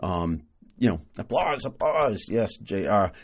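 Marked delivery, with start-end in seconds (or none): none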